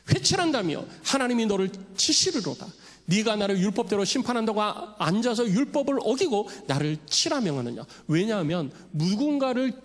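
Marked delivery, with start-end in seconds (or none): 0.1-7.8 raised, higher voice hurling a rebuke; acted rebuke. Paul rebukes the high priest, acted angry rebuke. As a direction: denounce him like Paul in the court. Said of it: "바울의 책망이 나오잖아요".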